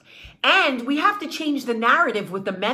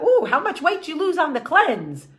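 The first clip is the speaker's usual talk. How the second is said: mock eager gossip; 6 semitones higher, 4 dB louder than usual. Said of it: "we're like".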